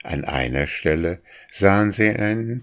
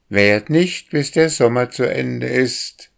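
higher, louder mocking call; 6 semitones higher, 4 dB louder than usual. A narrator's reading. Boy calls down to the drake, loud and mocking. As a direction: call down a cheeky retort while flying past. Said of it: "rief der Junge".

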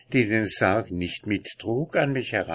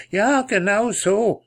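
excited; 14 semitones higher, 5 dB louder than usual. Acted. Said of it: "sagte der Totengräber".

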